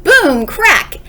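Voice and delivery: sharp high BOOM and CRACK; loud sound words. A narrator's reading. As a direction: bang them out like a crash. Crash noises in story.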